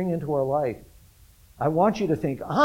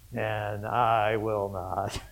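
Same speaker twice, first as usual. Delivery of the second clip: softer, very slow, dismissive "and I will not" with "I" stretched; quiet contempt; 7 semitones lower, 6 dB quieter, much slower than usual. Retold or imitated. Peter scorning the others to the Lord, soft and contemptuous. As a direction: murmur it with scorn, slowly dropping "I will not". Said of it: "a contempt in what he says".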